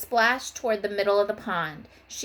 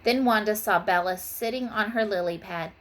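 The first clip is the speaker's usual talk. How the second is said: plain reading voice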